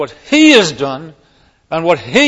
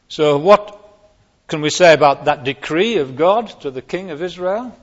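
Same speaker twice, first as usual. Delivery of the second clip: normal talking voice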